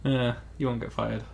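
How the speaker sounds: ordinary delivery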